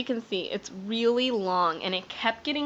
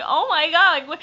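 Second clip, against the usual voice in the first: high, loud retold astonishment; acted-out incredulous exclamation; shocked disbelief; 8 semitones higher, 8 dB louder than usual. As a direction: re-enact a stunned outburst. Reacting to groom bawling, acted.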